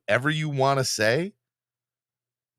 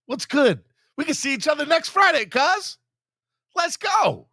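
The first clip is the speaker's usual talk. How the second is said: higher, playful call-out; mock hyped challenge; 4 dB louder than usual. Host playfully calling out the chatter, hyped. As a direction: hype him up like a fake fight.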